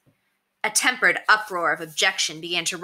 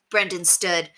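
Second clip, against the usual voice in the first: ordinary delivery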